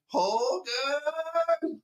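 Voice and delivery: high, slow mock German accent; a silly high German accent. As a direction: ham it up like an old sitcom German. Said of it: "here's mine".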